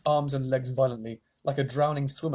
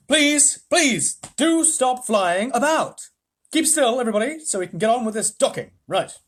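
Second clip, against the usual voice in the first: pleading Wonka; 8 semitones higher, 6 dB louder than usual. Acted. Wonka begging the flying grandparents to keep still. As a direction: beg them to stop.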